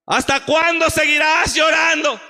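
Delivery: high, loud rebuking shout; God's rebuke shouted. Acted. Preacher voicing God rebuking Samuel, shouting. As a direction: call out the rebuke from above. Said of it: "Y dijo".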